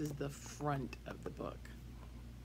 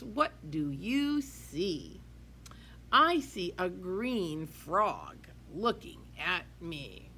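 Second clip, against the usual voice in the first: put-on lively sing-song read-aloud for kids; reading to children; 7 semitones higher, 3 dB louder, slower than usual. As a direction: read it bright and bouncy to a class. Teacher reads picture book aloud.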